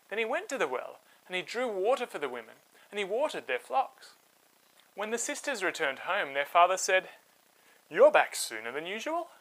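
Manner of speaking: put-on lively read-aloud voice for kids; storybook narration. A narrator's reading. Host telling children a Bible story, animated narration.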